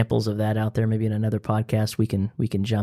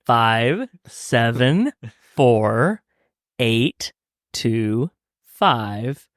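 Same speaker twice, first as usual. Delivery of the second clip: slow, spaced-out digits; deliberate number read-out; much slower than usual. Pretend. Host giving listeners the sales number, slow and deliberate. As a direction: dole out each digit slowly like an on-air appeal.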